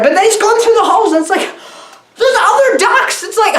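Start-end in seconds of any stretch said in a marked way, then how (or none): 2.2-3.2 high, amazed outburst; hammed-up astonishment, pitched way up; mock shock. Reviewer discovering other ducks in the halls, mock shocked.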